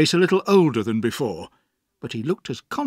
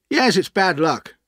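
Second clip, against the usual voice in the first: higher, louder offhand reply; breezy, unconcerned dismissal; careless; 6 semitones higher, 5 dB louder than usual. Acted. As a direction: brush off bad news. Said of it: "replied Steerforth carelessly".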